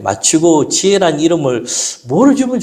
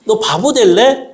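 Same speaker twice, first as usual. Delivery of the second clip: mock scolding father; 8 semitones higher than usual. Father scolds daughter in story.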